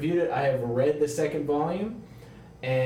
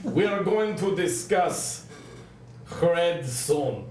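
put-on Russian accent; 3 semitones higher, slower than usual. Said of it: "I like how the accent keeps becoming something new".

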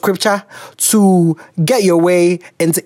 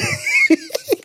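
high shrieking laugh; 5 dB quieter than usual. Real, not acted. Laughs at age remark.

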